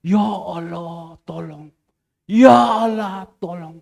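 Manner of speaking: long drawn-out plea, dropping away on "tolong"; retold cry for help. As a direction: imitate people crying out to God, stretching the call. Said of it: "yang mereka teriak".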